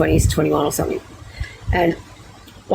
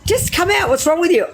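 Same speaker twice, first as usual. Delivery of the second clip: coaxing call; 11 semitones higher than usual. Retold or imitated. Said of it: "I said".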